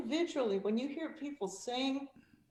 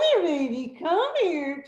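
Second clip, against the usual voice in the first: put-on cooing baby-talk voice, higher and louder; 5 semitones higher, 11 dB louder, slower than usual. Shown as a demonstration. Vocal coach demonstrating baby talk. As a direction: coo it like calling a baby. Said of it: "It's baby talk".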